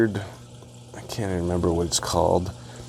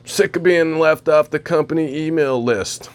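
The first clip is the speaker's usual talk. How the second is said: Seth Rogen impression; 6 semitones higher, 4 dB louder than usual. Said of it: "Like Seth Rogen from that Reese's commercial".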